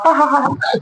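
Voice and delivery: high, soft mock "ha ha ha"; imitated laugh. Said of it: "she will just laugh".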